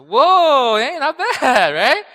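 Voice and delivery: loud, high, amazed whoa with a laugh; a burst of retold astonishment, laughing; imitating amazed surprise. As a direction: replay the shock of dad liking rap. His rap-hating dad liked the song.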